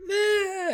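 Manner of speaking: a tiny frightened high squeak; scared squeak. Acted. A little girl squeaking in fear as she runs up. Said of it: "she's like".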